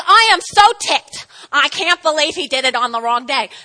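loud mock indignation; mock ticked leader.

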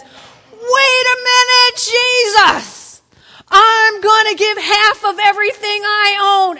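slow, high, loud proclamation; Zacchaeus pledging. Acted. Zacchaeus promising Jesus half his wealth, slow high loud vow. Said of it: "And he says".

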